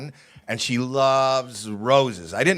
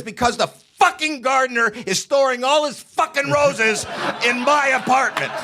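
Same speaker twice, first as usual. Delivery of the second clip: demented mom's furious yell; 10 semitones higher than usual. Retold or imitated. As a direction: bark it at your son like an angry old mom. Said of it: "She says".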